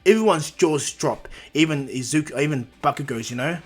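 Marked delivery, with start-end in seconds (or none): none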